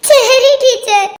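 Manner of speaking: slow, tearful schoolchild; weepy little pupil's reply; crying. Acted. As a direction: give in through acted sobs. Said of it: "அதனால அழவே கூடாது".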